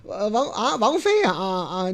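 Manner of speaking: ordinary delivery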